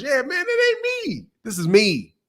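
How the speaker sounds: normal talking voice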